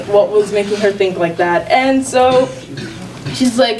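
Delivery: usual speaking voice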